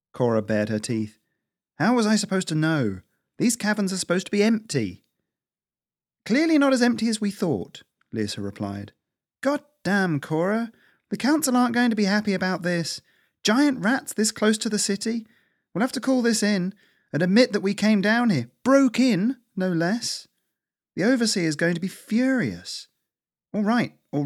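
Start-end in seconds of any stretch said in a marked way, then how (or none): 1.7-5.0 high, indignant squirrel voice; aggrieved self-defence; defensive protest. Acted. Squirrel defends herself in quarrel. 6.2-7.8 high, exasperated comeback; indignant squirrel, pitch way up; sarcastic retort. Acted. Sarcastic reply in quarrel. 9.4-22.9 high-pitched, fretful telling-off; worked-up, high exasperated rant; scolding squirrel, exasperated. Acted.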